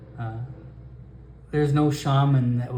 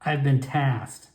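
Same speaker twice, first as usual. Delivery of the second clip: ordinary talk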